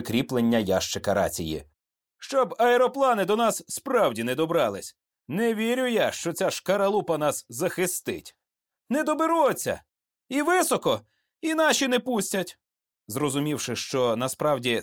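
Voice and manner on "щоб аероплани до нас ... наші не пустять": high-pitched worried soldier; anxious self-reassurance, pitched high; uneasy platoon leader. A narrator's reading. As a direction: fret aloud in a raised pitch. Frightened platoon leader doubts plane's protection.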